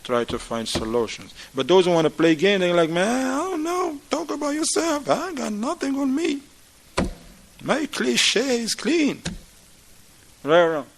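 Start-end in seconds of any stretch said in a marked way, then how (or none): 2.9-9.2 higher, dismissive brush-off; evasive denial. Acted. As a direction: shrug off all the blame. Husband dodging blame, playing games.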